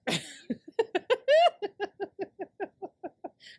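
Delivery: high, loud burst of laughter; long cackle. Real, not acted. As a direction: laugh till it peters out in giggles. Cracks up at own joke.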